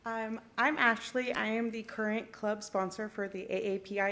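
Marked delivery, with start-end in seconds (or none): none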